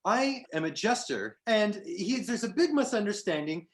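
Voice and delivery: a wronged fey jester, loud and indignant, begging travelers; satyr jester. Acted. GM voices the satyr Lou.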